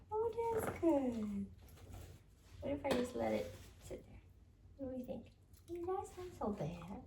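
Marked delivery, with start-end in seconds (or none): none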